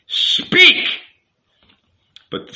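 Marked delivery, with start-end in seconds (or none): 0.1-0.9 loud, high, explosive order; commanding shout. A narrator's reading. Rabbi demanding the youth confess, commanding shout. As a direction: burst out, demanding an answer.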